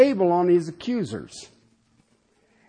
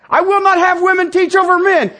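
high, loud and fast, hitting "not"; indignant shout; 10 semitones higher, 5 dB louder, faster than usual. Retold or imitated. Preacher mimicking critics of Paul, loud indignant shout. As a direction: shout it like an outraged objector. Mocking a critic's outraged quote.

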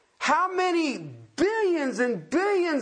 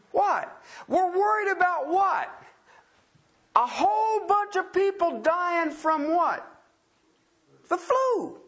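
high-pitched, incredulous questioning; comic bafflement, voice pushed up; mock disbelief; 6 semitones higher than usual. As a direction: scoff as if the worry is absurd. Mocking worry over a flu.

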